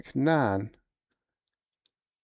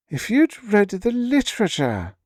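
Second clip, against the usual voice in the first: exasperated; 13 semitones higher, 4 dB louder than usual. Acted. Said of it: "She sighed in exasperation".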